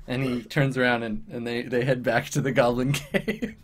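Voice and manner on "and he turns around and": usual speaking voice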